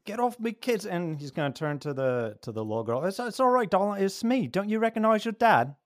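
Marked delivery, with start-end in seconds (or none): 0.1-0.9 high-pitched, forceful yell; father's angry shout. Acted. 3.1-5.8 high, loud pleading voice; frantic reassuring father. Acted. Father urgently reassures his daughter.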